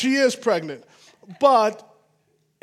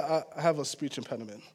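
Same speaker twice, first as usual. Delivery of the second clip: awkward reply; 9 semitones lower, 11 dB quieter, faster than usual. Retold or imitated. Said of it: "she said".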